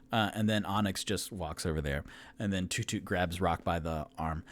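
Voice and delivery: normal talking voice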